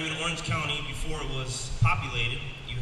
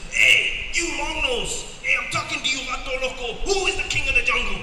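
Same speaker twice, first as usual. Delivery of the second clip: cocky lion; 9 semitones higher, 5 dB louder than usual. Acted. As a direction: swagger like a street-tough lion, chest out. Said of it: "he says".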